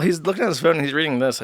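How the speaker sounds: usual speaking voice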